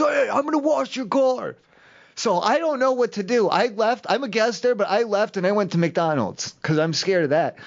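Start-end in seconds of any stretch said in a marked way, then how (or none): 0.0-1.6 imitating the guy. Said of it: "He goes".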